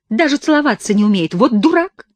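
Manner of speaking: mock his clumsiness. A narrator's reading. Girl mocks him. (насмешливо, громко)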